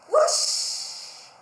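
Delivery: loud breathy whoosh, high. Acted. Water washing away the paint, whooshed loudly. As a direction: blow out a big rushing whoosh.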